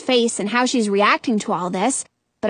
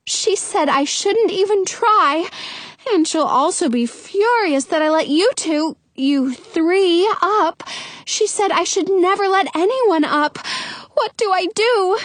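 high, fretful worrying; pitched-up, mildly distressed lament; moaning; 7 semitones higher than usual. A narrator's reading. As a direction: fret aloud in a high character voice. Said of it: "Rapunzel moans".

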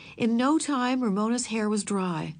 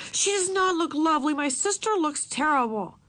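wanting to shout; 7 semitones higher than usual. A narrator's reading. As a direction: blurt it out like a kid. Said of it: "wanted to shout at Robert".

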